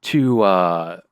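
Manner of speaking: loud, high, over-casual request; breezy phone bluff. Retold or imitated. Caller asking coworker for an email, loud high put-on breeziness.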